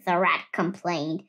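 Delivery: plain reading voice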